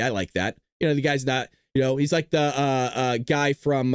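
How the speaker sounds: normal talking voice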